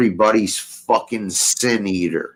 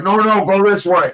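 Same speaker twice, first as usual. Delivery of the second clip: mock drunk insisting; 9 semitones higher, 4 dB louder than usual. Mimics drunk uncle insisting.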